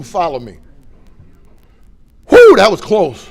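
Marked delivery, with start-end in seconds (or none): 2.3-3.3 loud, high, relieved exhale into words; relieved drunk whew. Retold or imitated. Past self after the hospital scare, a loud high whew. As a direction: play the drunk who just cheated death.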